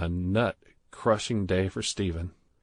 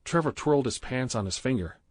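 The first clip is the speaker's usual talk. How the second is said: ordinary delivery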